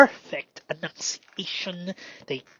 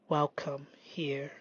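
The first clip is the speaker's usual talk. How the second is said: slow tender whisper; whispering welcome to the feeling; 2 semitones lower, 7 dB quieter, slower than usual. Real, not acted.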